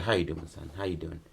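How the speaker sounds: low, hushed hello to son; soft greeting. Retold or imitated. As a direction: murmur it low, as to a child. Acts man greeting son, touching face.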